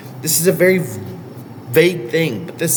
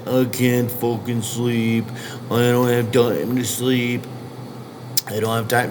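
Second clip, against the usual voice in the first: whiny excuse; 2 semitones lower, 4 dB quieter than usual. Retold or imitated. Coach mocking athletes who skip sleep, mock whine. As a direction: drag it out like a lazy complainer. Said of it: "the first thing people is gonna say".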